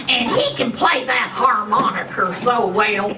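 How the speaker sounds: ordinary delivery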